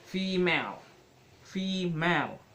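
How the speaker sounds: "female" with a long, strong first syllable; stress on first syllable. Shown as a demonstration. Teacher giving an example of stress, "female" strong at the start. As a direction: lean on the start of "female", keep the second lighter but clear. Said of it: "Say the first syllable strongly".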